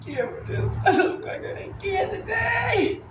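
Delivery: high, laughing shout cracking with tears. Retold or imitated. Shouting at staff over what they gave him, laughing yell. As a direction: yell it out half laughing, half crying.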